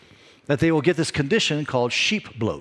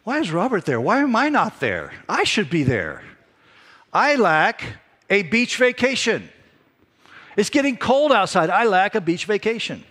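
mock self-pity; 8 semitones higher, 4 dB louder than usual. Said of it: "and I'm like".

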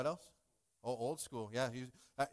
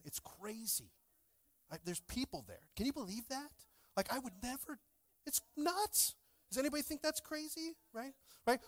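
mock amazement; 10 semitones higher, 4 dB quieter than usual. Joking about cold places.

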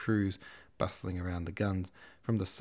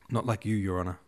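soldier voice; 4 dB louder than usual. A narrator's reading. Artilleryman's voice.

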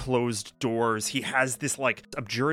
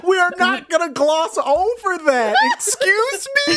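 much higher and louder, laughing disbelief; mock outrage; 10 dB louder than usual. Real, not acted. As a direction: pretend to be scandalised at the table. Player mock-outraged at the line.

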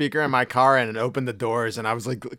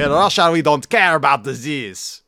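mock Russian; 3 semitones higher, 5 dB louder than usual. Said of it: "the guy".

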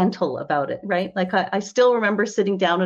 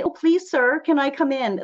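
mock timid plea, pitched up; a meek little plea for permission, hammed up; timid pleading; 6 semitones higher than usual. Mocking women who beg to come in, timid plea.

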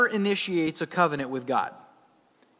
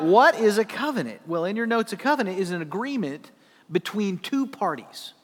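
ordinary talk, nothing marked

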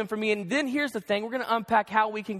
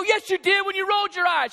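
heated accusation; 8 semitones higher than usual. Retold or imitated. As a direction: catch her out. He accuses her in argument.

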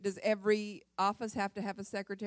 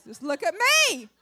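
playful plea; 7 semitones higher, 7 dB louder than usual. Retold or imitated. Pleading for husband's attention.

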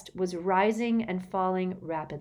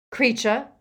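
higher, louder warning by name; fierce; 6 semitones higher, 6 dB louder than usual. A narrator's reading. Harry confronts the elf, fierce. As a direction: address the servant fiercely. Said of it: "said Harry fiercely".

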